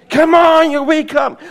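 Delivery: high, loud rousing shout; urgent wake-up call. Retold or imitated. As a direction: jolt him awake. Angel rousing sleeping Peter.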